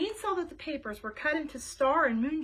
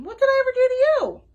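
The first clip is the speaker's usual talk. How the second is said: high, loud hurt outcry; indignant yell; 11 semitones higher, 13 dB louder than usual. Acted. Bean yelling at Ivy, hurt and indignant, loud. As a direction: throw the question back at her, loud. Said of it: "yelled Bean".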